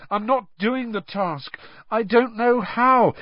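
high, distressed confession; hopeless. A narrator's reading. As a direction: admit defeat in despair. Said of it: "Harry said hopelessly".

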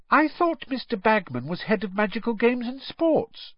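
high, puzzled question; surprised. A narrator's reading. Ginny questioning Mister Weasley, surprised and high. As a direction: wonder aloud. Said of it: "looking surprised".

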